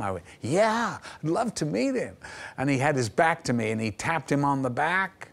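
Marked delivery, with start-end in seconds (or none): 0.4-2.2 high-pitched, thrilled yes; eager. Retold or imitated. Actor offered a meeting with Gene Kelly, eager. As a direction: jump at the invitation, voice leaping up. Star-struck reply to studio minder.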